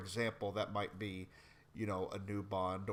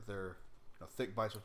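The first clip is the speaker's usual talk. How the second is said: ordinary talk, nothing marked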